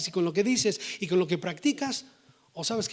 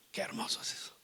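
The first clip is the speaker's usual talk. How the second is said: soft awed whisper; 14 dB quieter, faster than usual. Real, not acted. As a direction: breathe it out in wonder. Awed aside before repeating. (susurro asombrado)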